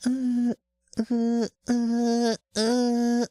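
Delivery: high falsetto vocal slides; high falsetto uhs. Shown as a demonstration. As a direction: slide up into head voice on uh. Vocal warm-up sounds in high voice.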